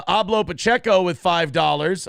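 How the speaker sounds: usual speaking voice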